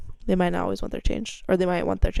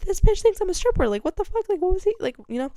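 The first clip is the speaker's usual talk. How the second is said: much higher, loud disbelief to coworkers; outraged gossip; 12 semitones higher, 3 dB louder, faster than usual. Retold or imitated. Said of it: "I'm like".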